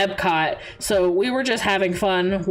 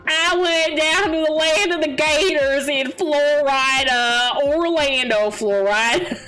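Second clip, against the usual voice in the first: mock Southern Florida twang, pitched up; Florida accent; 5 semitones higher than usual. Streamer jokes about going down to Gatorland, mock Florida accent. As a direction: put on a hammed-up Florida accent. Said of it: "how do I make it sound more Florida".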